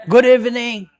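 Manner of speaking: loud, high, energetic good evening; excited greeting. Shown as a demonstration. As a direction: greet the crowd with real excitement. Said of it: "Come on, be more excited".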